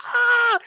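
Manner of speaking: high-pitched mock wail; short, high imitation of crying; imitated crying wail. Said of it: "she was crying".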